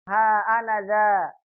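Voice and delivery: "ha anaza" slow, long ha, clear; corrected reading. Shown as a demonstration. Teacher giving the correct "ha anaza" after a slip. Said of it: "Yang betul apa".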